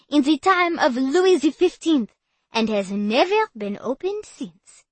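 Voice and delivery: put-on loud tour guide, French accent; French guide addressing tourists. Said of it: "English with a heavy French accent".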